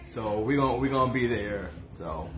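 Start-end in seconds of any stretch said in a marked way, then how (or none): none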